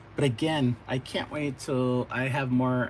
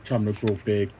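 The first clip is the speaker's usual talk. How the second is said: lower, confident hero voice; [name], lower and steady, to his sister; [name] growing big; 5 semitones lower than usual. Acted. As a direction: puff up as you grow. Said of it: "[name] tells Kayla".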